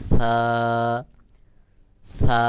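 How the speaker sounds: সা very thin, with air flowing out; very thin, airy. Shown as a demonstration. Teacher shows the correct letter sound, thin airy সা. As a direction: draw out a thin সা, pushing breath outward. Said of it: "আওয়াজটা একেবারে পাতলা করে উচ্চারণ হবে".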